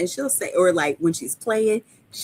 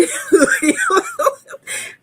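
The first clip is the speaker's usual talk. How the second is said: a squealing laugh breaks out, high up; high laughing fit; 5 dB louder than usual. Real, not acted. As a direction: laugh so hard it cracks up high.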